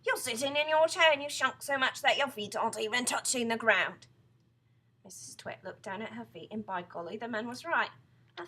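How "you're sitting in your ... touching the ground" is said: loud, raised triumphant shout. A narrator's reading. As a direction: shout it accusingly at her. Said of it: "pointed at her and shouted".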